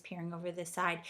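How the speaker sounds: ordinary delivery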